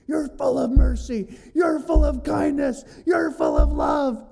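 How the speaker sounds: high, loud furious yelling at God; angry shout. Retold or imitated. Jonah yelling at God about his mercy, furious. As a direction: shout the praise as an accusation. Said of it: "He's angry".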